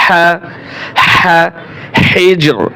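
হা squeezed in the throat, raspy; pressed throat ha. Shown as a demonstration. Teacher showing the small হা in হিজর. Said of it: "হাই হলটি গলার ভিতরে চাপিয়ে".

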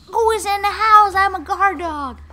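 high, loud guard-dog voice; Roxy the dog. Acted. Player voicing the dog freaking out, frantic loud impression. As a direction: bellow as an alarmed dog on duty. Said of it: "Roxy's like".

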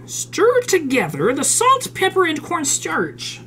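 high, slow, exaggerated recipe reading. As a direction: draw out each step in a high, swooping voice. Reading recipe aloud theatrically.

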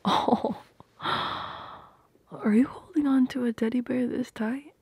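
breathy gasp, then slow whispered surprise; surprised gasp. Acted. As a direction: gasp as you find the teddy bear. Discovers teddy bear hug.

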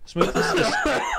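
words dissolve into breathy laughter; can't finish for laughing. Real, not acted. Breaks up laughing mid-sentence.

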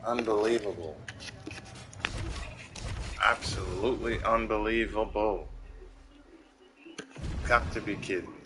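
subdued, deflated disbelief; stunned disbelief. Real, not acted. Gamer taking in the bad round, stunned disbelief. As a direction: say it softly, drained after the rant.

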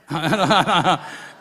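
a loud burst of real laughter. Real, not acted. Pastor laughs at his mother's answer, loud laugh.

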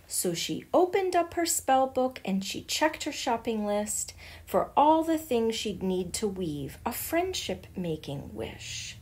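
usual speaking voice

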